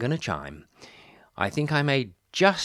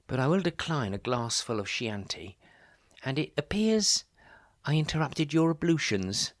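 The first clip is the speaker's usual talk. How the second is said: usual speaking voice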